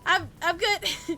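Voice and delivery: nervous high refusal. Retold or imitated.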